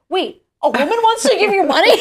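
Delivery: high, loud, laughing disbelief as the husband; husband on the phone, laughing in shock; incredulous husband. Retold or imitated. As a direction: play him gobsmacked, laughing. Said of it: "And he was like".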